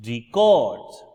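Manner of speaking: second syllable of records hit loud; stress on CORD in records. Shown as a demonstration. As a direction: punch CORDS in records. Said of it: "the second one".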